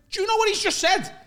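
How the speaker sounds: high, loud retort from a retold row; acted indignant shout. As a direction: throw it back as a mock outraged yell.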